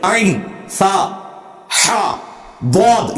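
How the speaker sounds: each Arabic letter sounded separately and fully; careful Arabic articulation. Shown as a demonstration. Showing critics how Arabic letters must come out, precise articulation. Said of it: "ये सही निकलना चाहिए".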